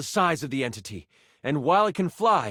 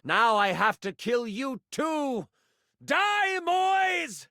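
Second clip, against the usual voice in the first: murderous villain; 6 semitones higher, much slower than usual. Acted. Villain attacks a new arrival, slow threat into high cry. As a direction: menace rising to a high cry.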